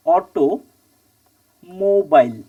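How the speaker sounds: "Automobile" very slowly in two halves, long gap after অটো; broken into parts. Shown as a demonstration. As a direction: split "automobile" as অটো, pause, mobile. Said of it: "কটা এলো?". ("Automobile" খুব ধীরে, মাঝখানে লম্বা বিরতি)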